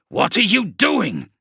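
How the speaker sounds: spat. Acted. Kravrek spitting at the sorcerer, high angry voice. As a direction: spit the words at him. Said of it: "Kravrek spat".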